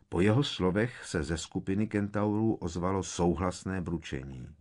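ordinary delivery, nothing marked

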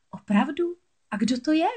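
high, questioning mermaid voice; curious young mermaid. A narrator's reading. Mermaid asking the morays who can help, curious. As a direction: ask eagerly, pitched well up.

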